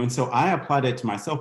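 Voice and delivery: ordinary talk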